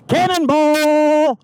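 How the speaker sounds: long high shout of Cannonball; drawn-out exclamation. Real, not acted. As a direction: stretch it out, high and amused. Rider falls, laughing exclaim.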